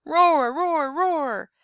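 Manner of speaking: high loud roars fading off; a boy struggling and roaring, first roar loudest; shouted roars. A narrator's reading. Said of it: "he shouted".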